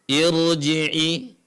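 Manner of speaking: Irji'i read with Quranic recitation, the r thick and full; recited Arabic word. Shown as a demonstration. Teacher recites the example word for students, slow and full. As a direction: recite Irji'i slowly as in tajwid. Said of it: "Contohnya ini, irji'i".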